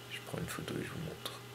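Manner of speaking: soft, fast whisper to himself; hushed quick whisper while taking the photo. Real, not acted. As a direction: whisper it quickly so as not to disturb. Whispered repeat while shooting.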